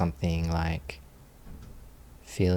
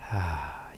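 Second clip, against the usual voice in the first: quiet, falling sigh of release; soft sigh; 7 dB quieter than usual. Shown as a demonstration. Teacher describing the settling feeling, soft relaxed sigh. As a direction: let a small settling sigh out.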